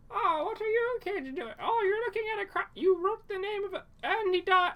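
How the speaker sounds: very high squeaky lunch lady, building to a shriek. Acted. Lunch lady walks in on the kids, rising hysteria. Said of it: "Imagine just a lunch lady walks in".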